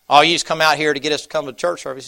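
a poor son demanding to know why; defiant farm boy. Acted. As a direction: push back hard at the preacher. Farm boy scoffs at preacher.

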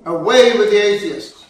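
loud, forceful cry to a crowd; defiant shout. Retold or imitated. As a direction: call it out hard at a jeering mob. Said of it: "looked at the jeering mobs in the stadium and said".

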